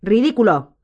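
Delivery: loud, commanding incantation; firm, ringing command; firm and clear. A narrator's reading. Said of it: "con voz firme y clara".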